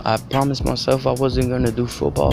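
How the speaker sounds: ordinary talk, nothing marked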